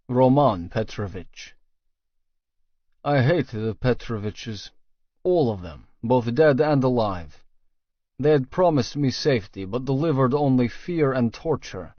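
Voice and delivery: plain reading voice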